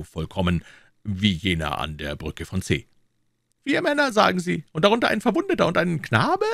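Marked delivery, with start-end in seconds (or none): 3.6-6.5 very high-pitched king's voice; amazed king. Acted. King questioning Treville about the fighters, amazed high voice. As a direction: ask in astonishment, voice pitched high.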